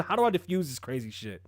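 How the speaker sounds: usual speaking voice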